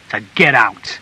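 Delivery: firm, forceful dismissal at natural pitch; angry order. A narrator's reading. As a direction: order her out through held-in rage. Husband orders wife out.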